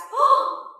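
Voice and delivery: high, rising mock gasp; effeminate gasp. A narrator's reading. Comic on stage, high mock effeminate gasp. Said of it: "super offensive, borderline effeminate gasp".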